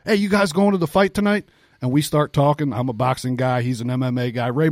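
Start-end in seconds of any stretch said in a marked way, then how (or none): 0.0-1.5 imitated holler. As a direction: call out to strangers like a friendly holler. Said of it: "He starts hollering like".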